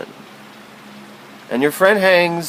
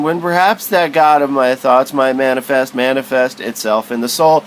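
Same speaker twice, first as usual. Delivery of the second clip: ordinary talk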